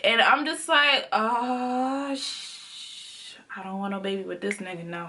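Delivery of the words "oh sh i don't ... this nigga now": hushed, low aside under the breath; retold muttered dismay. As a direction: trail off into a quick, soft mutter. Retold muted dismayed reaction.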